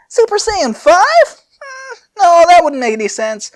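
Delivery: squeaky high musing inventor; high Fu pondering aloud. Acted. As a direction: go way up high and play the giddy scientist. Fu voice held.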